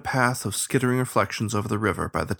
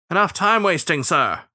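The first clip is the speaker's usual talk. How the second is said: higher-pitched, irritated snap; miffed snap; 8 semitones higher, 4 dB louder than usual. A narrator's reading. Starling losing patience with the captain, snapped. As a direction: cut him off, miffed. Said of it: "the starling snapped, apparently miffed".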